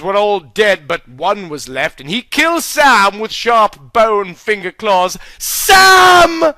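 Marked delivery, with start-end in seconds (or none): 5.4-6.5 high, loud cry of a friend's name; anguished cry. Acted. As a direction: shout his name in shock. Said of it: "cried Frodo".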